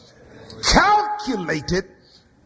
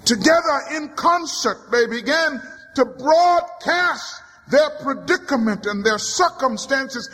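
ordinary delivery

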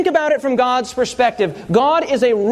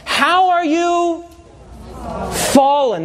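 slow, higher mournful reading; lamenting prophet; 5 semitones higher, slower than usual. Acted. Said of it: "Notice what he says in verse twelve".

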